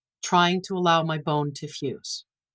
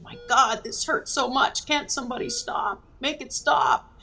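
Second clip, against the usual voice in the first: high-pitched cry of pain, held back in volume; mock scream, pleading for relief without shouting; imitated pained scream; 6 semitones higher than usual. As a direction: retell crying out in fear and pain. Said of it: "fear and pain and screamed".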